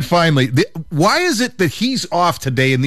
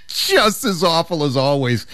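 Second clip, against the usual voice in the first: laughing. Real, not acted. Laughing at bad dad jokes.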